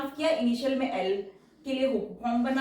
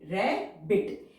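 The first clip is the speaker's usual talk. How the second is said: Rabbit stretched very slowly, syllable by syllable; slow drawn-out rabbit; 3 semitones lower, much slower than usual. Shown as a demonstration. Teacher says rabbit slowly while drawing its outline. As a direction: draw out rabbit so students can follow the strokes. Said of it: "जैसे हम इसे बनाएंगे".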